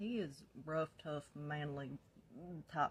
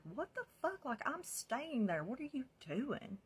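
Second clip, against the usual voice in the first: high, indignant outburst; retold mad phone call; 10 semitones higher, 5 dB louder than usual. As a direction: demand answers, pitch shooting up. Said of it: "I call Zach mad".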